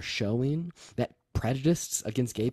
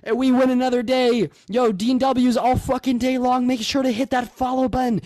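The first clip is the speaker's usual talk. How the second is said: hyped; 11 semitones higher, 5 dB louder than usual. Real, not acted. Hype stream opener shouted.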